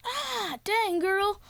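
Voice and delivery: high-pitched acted yelp; hammed-up hurt reaction; mock pained cry. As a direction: squeal as if just struck. Pole hits guy's face.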